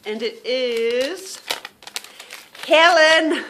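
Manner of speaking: drawn-out build-up, then a loud, high name; slow suspense bursting into a high call; winner announcement. Real, not acted. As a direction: reveal the winner with a big shout.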